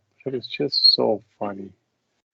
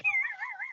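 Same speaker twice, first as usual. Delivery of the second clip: high burst of laughter; 10 dB quieter than usual. Real, not acted. Laughs at the client.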